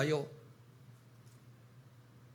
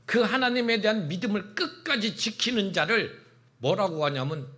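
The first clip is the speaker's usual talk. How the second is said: ordinary talk, nothing marked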